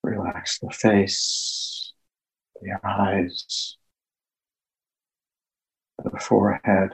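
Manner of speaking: slow, hushed meditation guide with long pauses; guided meditation. Acted. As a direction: ease listeners into calm, phrase by phrase, leaving long silences.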